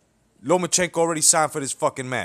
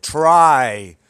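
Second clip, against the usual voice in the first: "try" drawn out and much louder; stress on try; 8 dB louder than usual. Real, not acted. Vlogger mocking the WBC's effort, hammering try. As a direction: lean hard on "try" with scorn. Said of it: "and I emphasize on that word".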